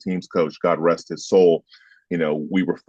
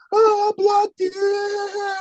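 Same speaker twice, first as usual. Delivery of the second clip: high, slow, mocking sing-song; mocking opponent; much slower than usual. Lineman mocking the opponent who grabs him, high and slow. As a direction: mimic a smug lineman, very high and drawn out.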